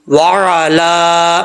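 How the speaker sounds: very slow वाला with throaty ain and held laa; lengthened lam. Shown as a demonstration. Teacher shows the vowel length, careful tajweed. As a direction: stretch the last vowel of वाला. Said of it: "इसको लंबा करेंगे".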